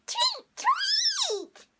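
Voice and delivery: very high drawn-out tweeting; high-pitched pretend chirp; birdie imitation. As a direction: tweet like a little bird. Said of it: "What does the birdie say?".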